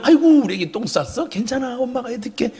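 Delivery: high cooing that softens toward the end; coddling mother. Acted. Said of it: "이게 파라칼라오". (아기 달래는 엄마 말투)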